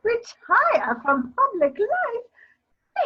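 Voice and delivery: high, slow, incredulous question; shocked disbelief. Acted. Lady Caversham aghast at his retiring, shocked disbelief. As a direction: drag out the disbelief.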